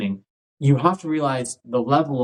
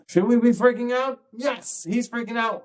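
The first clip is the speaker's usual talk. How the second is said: put-on higher, alarmed kid voice; mock alarmed kids; 10 semitones higher than usual. Kids copying their panicking teacher, lightly voiced higher alarm. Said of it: "And they're like".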